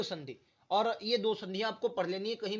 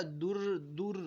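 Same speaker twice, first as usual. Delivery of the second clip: slow syllable; 4 dB quieter, much slower than usual. Shown as a demonstration. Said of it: "लेकिन बोलिए कैसे".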